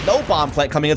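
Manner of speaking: usual speaking voice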